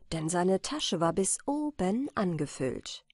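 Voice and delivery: usual speaking voice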